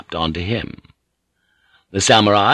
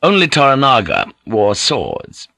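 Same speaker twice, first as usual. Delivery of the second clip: ordinary delivery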